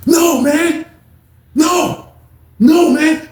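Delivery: high shouted refusal; shouting. Retold or imitated.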